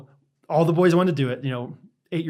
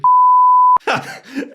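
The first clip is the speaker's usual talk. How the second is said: squealing laugh; real high laughter; 10 dB louder than usual. Real, not acted. Laughing at the kid's comeback.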